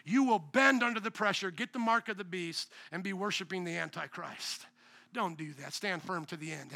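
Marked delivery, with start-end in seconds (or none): 2.9-6.7 much softer and lower; solemn hush. Real, not acted. Pastor warning of end times, solemn and hushed. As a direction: drop to a grave hush.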